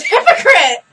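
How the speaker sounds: loud, confident blurt of "Hypocrite!"; imitating a friend loudly confusing it with hippogriff; blurted mix-up. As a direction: shout out the wrong word proudly. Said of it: "And she goes".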